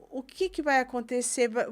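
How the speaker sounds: normal talking voice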